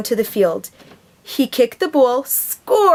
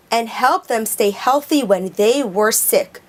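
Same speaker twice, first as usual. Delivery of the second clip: plain reading voice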